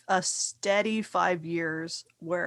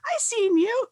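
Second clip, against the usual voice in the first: high-pitched mock excited nurse; excited fan; 12 semitones higher, 6 dB louder than usual. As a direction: imitate a starstruck nurse, voice shooting way up. Nurse recognizes actor mid-exam.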